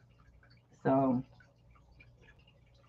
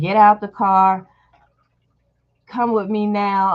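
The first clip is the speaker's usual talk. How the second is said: louder, barked command from the stalker; retold angry order; 3 semitones higher, 6 dB louder than usual. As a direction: order him out of the car. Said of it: "she was like".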